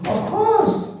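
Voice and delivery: high-pitched exclamation, acted out; mock-indignant squeal of agreement; indignant retort. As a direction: burst out as if it were obvious. Acted retort, angry when insulted.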